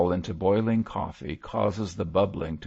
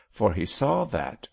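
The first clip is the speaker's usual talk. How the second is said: ordinary delivery, nothing marked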